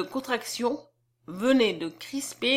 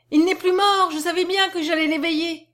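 put-on high, loud, elated child; triumphant little girl's outburst; overjoyed cry; 7 semitones higher, 5 dB louder than usual. A narrator's reading. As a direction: exclaim it loudly, wild with joy. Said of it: "folle qu'elle était de joie".

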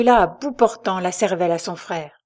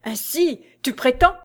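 shouted accusation; 5 semitones higher than usual. Acted. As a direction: yell it in his face, then choke. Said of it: "lui cria".

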